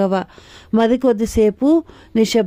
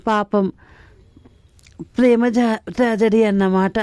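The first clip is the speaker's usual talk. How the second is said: plain reading voice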